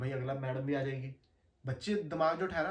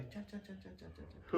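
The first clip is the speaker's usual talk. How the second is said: soft, higher chirping "चा चा चा" like sparrows; imitating bird chirps; 4 semitones higher, 7 dB quieter, slower than usual. Telling friends a school story, soft imitated bird chirping. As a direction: chirp it quietly like birds outside the classroom. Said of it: "थोड़ी थोड़ी चिड़ियों की आवाज आ रही".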